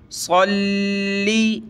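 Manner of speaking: slow सल्ली with a heavy hissing sad and a held lam; सल्ली, sad thick, lam doubled; whistled heavy sad. Shown as a demonstration. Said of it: "साद को मोटा किया सीटी की आवाज़ के साथ". (साद मोटा, लाम जमा कर)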